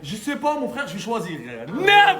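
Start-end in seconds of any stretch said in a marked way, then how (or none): 1.7-2.2 sharp loud curse; loud outburst. Real, not acted. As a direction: blurt it out loud. Sudden outburst.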